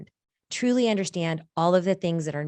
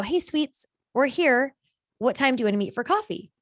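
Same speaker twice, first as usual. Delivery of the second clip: higher-pitched, breezy check-in; breezy greeting; 6 semitones higher than usual. Retold or imitated. Texting him on arrival to meet for coffee, breezy. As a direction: sing out a casual hello.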